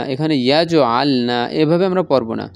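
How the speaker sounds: ordinary delivery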